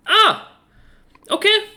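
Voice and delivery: very high, pleased little hoot of a reply; pleased owl innkeeper. Acted. Owl innkeeper accepting payment, very high and pleased. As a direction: accept the coin like a delighted owl. Owl takes the gold coin.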